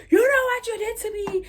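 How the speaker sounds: high, loud accusing wail; crashing out. Retold or imitated. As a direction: hurl a hammed-up hysterical accusation. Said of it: "she's there crashing out".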